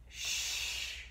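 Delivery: a long hushing shh; quiet-please shh. Shown as a demonstration. Yoga teacher shows the wood sound, hushing shh. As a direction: hush the room like a librarian. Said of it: "like you're telling somebody to be quiet".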